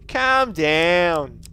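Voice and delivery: loud, slow mock scolding; drawn-out loud "calm down, mate". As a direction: boom it out like a mate telling you off. Mock retort to the game.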